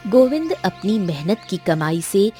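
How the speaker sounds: ordinary delivery, nothing marked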